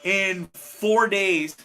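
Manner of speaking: in four days drawn out slow and loud; slow, stressed. Real, not acted. Host stressing how quickly he was sent home, slow and emphatic. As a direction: stretch every word of in four days.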